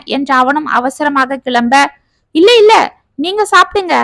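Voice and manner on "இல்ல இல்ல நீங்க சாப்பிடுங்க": flustered friend blurting a refusal; hasty refusal. Acted. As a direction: brush him off in a rush, voice jumping up. Friends rushing away, refusing Sethu.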